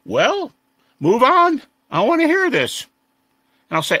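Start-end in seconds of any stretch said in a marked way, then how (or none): 0.0-2.9 called out high and loud from the crowd; good-natured call-out. Retold or imitated. Atheist in the audience heckling kindly, loud and high. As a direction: shout it across the hall, friendly. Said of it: "call out good-naturedly".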